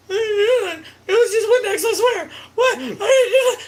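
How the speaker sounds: high, laughing mock plea; panicked denial. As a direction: plead innocence in a high voice through laughter. Retold panicked denial to stepmom.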